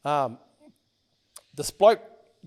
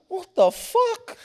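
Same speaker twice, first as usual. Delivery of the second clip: drawn-out, high-pitched shock; slow, rising, incredulous exclamation; stunned disbelief; 13 semitones higher, much slower than usual. Retold or imitated. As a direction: re-enact being floored by shocking news. Reacting to friend being shot.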